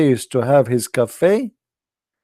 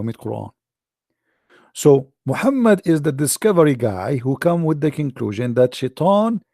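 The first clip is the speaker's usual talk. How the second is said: usual speaking voice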